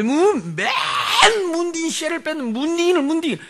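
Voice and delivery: high, exaggerated cursing of one's kids; mock parental cursing. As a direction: imitate a parent railing at the children. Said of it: "자식들 보고".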